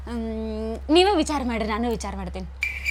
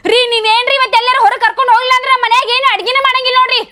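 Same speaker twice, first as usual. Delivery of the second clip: angry threat; 6 semitones higher than usual. Acted. Wife threatens husband in quarrel.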